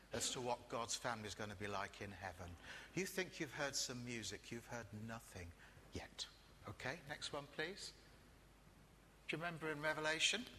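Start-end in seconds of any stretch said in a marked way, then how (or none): 4.5-6.3 soft whispered tease. Acted. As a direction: drop to a hushed secret. Preacher teases about heavenly music.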